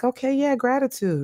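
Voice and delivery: a small inner aha, said to herself; mild realization. Retold or imitated. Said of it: "I'm just like".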